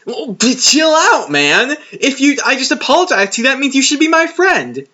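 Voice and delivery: lightly hammed-up smug talking-down; condescending. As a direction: imitate a smug, patronizing hero brushing her off. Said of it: "He's just so condescending".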